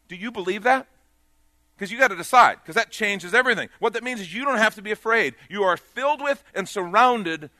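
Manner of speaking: loud, rising preacher's call; full-force preaching. Acted.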